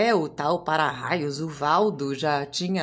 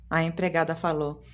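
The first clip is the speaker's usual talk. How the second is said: plain reading voice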